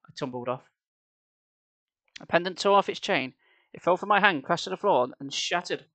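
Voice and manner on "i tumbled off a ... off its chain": usual speaking voice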